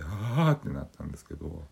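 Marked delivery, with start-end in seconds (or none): 0.0-0.5 high loud aghast gasp; dismayed gasp. Retold or imitated. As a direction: gasp in horror. Horrified groan.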